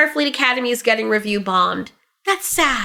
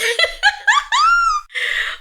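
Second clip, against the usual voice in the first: shrieking laughter. Real, not acted. Cracking up at own impression, shrieking with laughter. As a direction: burst into a screaming laugh.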